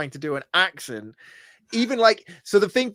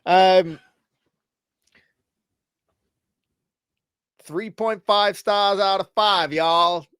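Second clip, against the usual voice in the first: slow, hesitant attempt at a Southern US accent; half-hearted Southern US accent, higher pitched; 5 semitones higher, slower than usual. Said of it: "Southern U S A, please".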